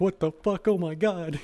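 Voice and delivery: high, loud mock panic; voicing panicked dogs. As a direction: play the dogs freaking out. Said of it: "the dogs are like".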